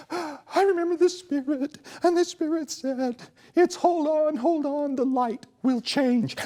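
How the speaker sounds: high, overwrought mock-earnest singer voice; mocking impression of himself. Comedian lampooning Michael on stage, hammed-up high emotional voice. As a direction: ham up a weepy songwriter recalling divine inspiration. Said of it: "it was mocking".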